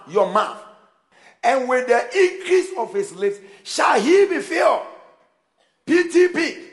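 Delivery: high, loud-peaking sermon delivery; full-force preaching. Real, not acted. Preacher warning congregation about their words, full-force preaching. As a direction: press each point on the congregation, measured and emphatic.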